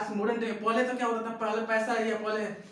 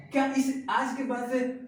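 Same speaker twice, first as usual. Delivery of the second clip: normal talking voice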